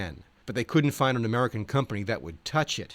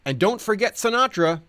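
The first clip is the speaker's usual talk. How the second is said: higher, louder eager cowboy voice; imitating the Ledge; 10 semitones higher, 4 dB louder than usual. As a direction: insist like the quirky cowboy. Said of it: "The Ledge advised".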